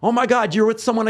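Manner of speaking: mock an excitable girl's surprise; excited gushing. Girl meeting his girlfriend, high excited mock gushing. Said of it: "was so happy".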